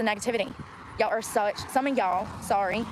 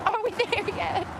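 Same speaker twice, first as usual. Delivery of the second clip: put-on whiny kid; 9 semitones higher, slower than usual. Pretend passenger on long drive.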